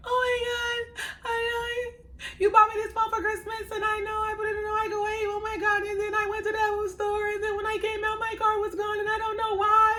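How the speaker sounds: crying to her husband on FaceTime about the lost phone and car; breaking down crying. Retold or imitated. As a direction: blurt it all out through tears to your husband. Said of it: "I just break down".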